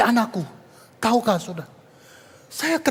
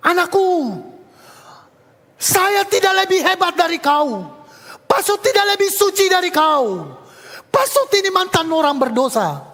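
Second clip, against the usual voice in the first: raised, loud, heartfelt plea to a student; earnest confession; 8 semitones higher, 4 dB louder than usual. Retold or imitated. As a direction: open your heart to the crying kid. Pastor earnestly comforting crying student.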